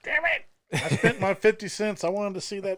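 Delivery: high, loud, hammed-up complaint; mock childish outrage. Moviegoer cheated of Darth Vader's end, mock outraged wail.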